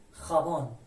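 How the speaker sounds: خابان starting with throaty kh. Shown as a demonstration. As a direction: say خابان with the j pronounced kh. («خابان» با صدای خ)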